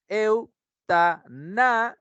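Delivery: Eutana dragged out, stressing na; syllable stress demo. Shown as a demonstration. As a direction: stretch Eutana slowly, leaning on na. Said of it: "en na encuentro la mayor intensidad".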